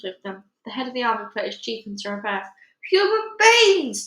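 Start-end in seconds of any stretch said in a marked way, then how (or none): 2.9-4.1 huge, high, slow giant yell. A narrator's reading. Giant spotting humans, yelling in outrage. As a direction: roar it out, stretching each word. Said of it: "the flesh on Peter yelled".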